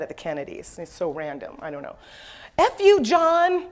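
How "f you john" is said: retold street shouting. Man on Forty-second Street mad at the Kennedys, imitated shouting. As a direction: imitate a ranting man, pitched up, punching each name. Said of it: "he's shouting".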